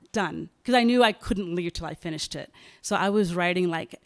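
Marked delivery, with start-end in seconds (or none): 0.0-0.5 laughing it out; relieved laugh. Real, not acted. Relief at finishing the novel.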